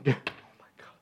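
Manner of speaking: breathless awed whisper. Acted. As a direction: gasp it out in amazement, barely voiced. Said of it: "Nathanael exclaimed".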